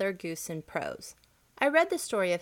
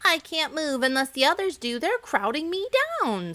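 higher, louder protest from a crowded egg; squashed egg Caw-Cut; 8 semitones higher, 5 dB louder than usual. Acted. Caw-Cut complaining to Cluckety Cluck, higher and louder. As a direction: whine that you're being pushed down. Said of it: "said Caw-Cut".

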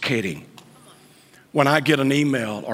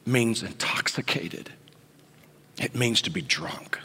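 hushed whisper; 5 semitones lower, 7 dB quieter than usual. Real, not acted. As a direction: whisper it low and secret. Preacher drops to whisper.

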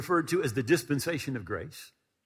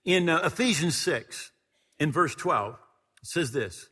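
loud, high fervent preaching; full-force preacher's delivery; 6 semitones higher, 7 dB louder than usual. Acted. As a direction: drive the scripture home to the congregation.

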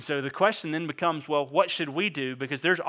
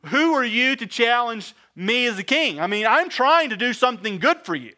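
louder and higher indignant protest to God; a king's indignant outcry, higher and louder; indignant David; 8 semitones higher, 8 dB louder than usual. Retold or imitated. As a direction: confront God, hurt and outraged. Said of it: "he's mad because he's like".

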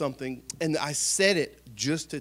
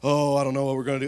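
mock panicked whining; 3 dB louder, much faster than usual. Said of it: "I'd be like".

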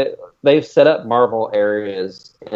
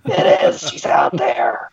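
slow, high, eerie mock-mysterious voice; mock spooky; 13 semitones higher, slower than usual. Joking to cohosts that Nessie exists, mock eerie. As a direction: tease like a TV mystery host, slowly.

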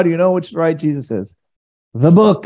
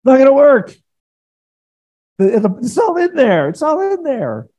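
emphatic exclaim; 10 semitones higher than usual. Real, not acted.

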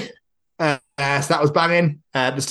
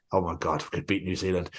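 softer, lower, awed "we could beat New Zealand"; awed hush; 5 semitones lower, 8 dB quieter than usual. Retold or imitated. Dreaming of beating New Zealand, hushed and awed. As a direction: breathe it in quiet awe. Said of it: "So now it's like".

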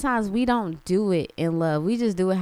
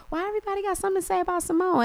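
high, fast, incredulous; baffled quote; 10 semitones higher, much faster than usual. Retold or imitated. Vlogger recalls reacting to the Simone headlines, high and rushed. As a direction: blurt it out in disbelief. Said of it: "until I was like".

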